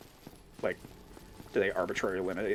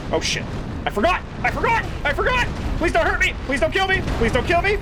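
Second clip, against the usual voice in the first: high shrieking pleas for mercy; terrified horror victim; 6 dB louder, faster than usual. Pretend. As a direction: beg for your life, screaming. Pleads with game boss.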